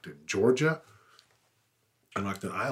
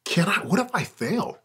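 higher and louder, anxious self-doubt; mock panicked question, pitched up; retold fear; 9 semitones higher, 7 dB louder than usual. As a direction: blurt out the worry as if scared again. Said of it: "And I was afraid".

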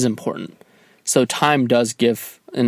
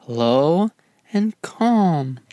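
lightly voiced little girl, higher; a hint of a small child's voice; playful imitation of a little girl from a video; imitating a little girl; 5 semitones higher, much slower than usual.

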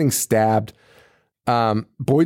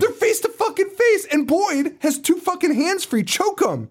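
exasperated rant. Real, not acted. Ranting at the film.

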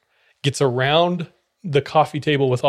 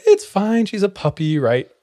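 reassuring relatives; 6 semitones higher than usual. Retold or imitated. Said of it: "Everyone was like".

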